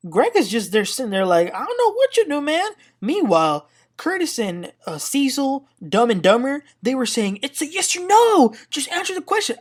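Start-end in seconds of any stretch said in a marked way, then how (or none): 1.5-2.7 high, squeaky mock bewildered voice; mock clueless Greg. Recapper mocks Greg answering Damon, high and baffled. 7.4-9.6 high, loud mock nagging; mocking pushy cohosts. Mocking Curtis and Cecil pressing Greg, high and whiny. Mimicking the guys pressing Damon.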